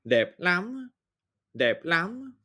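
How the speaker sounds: "dep lam" with a nasal A, spoken slowly; pronunciation model. Shown as a demonstration. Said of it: "comme dans l'exemple".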